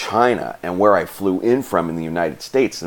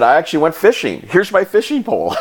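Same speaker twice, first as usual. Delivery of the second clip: giggly explanation; 11 semitones higher, 4 dB louder than usual. Retold or imitated. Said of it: "I said".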